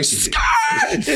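very high shrieking cry; wild shriek. Retold or imitated. Mister Gray snapping at Pete, a shrill shriek. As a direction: screech the word way up high. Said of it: "And he like".

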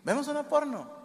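high-pitched imitation of the wife. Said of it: "mi esposa me dijo".

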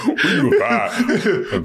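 normal talking voice

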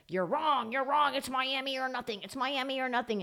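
mock insistent fans. Host mimicking Miami fans who said he's wrong, mock insistence. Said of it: "fans said".

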